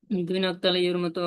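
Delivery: ordinary talk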